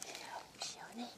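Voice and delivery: soft, breathless whisper to a goat; whispered hello. Real, not acted. Leaning in to a goat, a gentle whisper. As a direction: hush it so you don't startle her.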